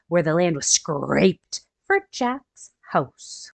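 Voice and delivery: storybook read-aloud for little ones; gentle picture-book narration; reading to children. A narrator's reading. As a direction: read it like story time for kids.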